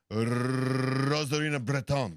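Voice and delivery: very long trilled Spanish R, slow name; exaggerated Spanish accent. Host needles co-host, exaggerated Spanish accent. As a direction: stretch the Spanish roll to absurdity.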